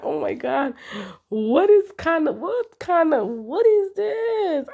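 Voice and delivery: a mock-crying plea of disbelief; climbing, exaggerated outrage; shocked disbelief. Exasperated at her mood switch.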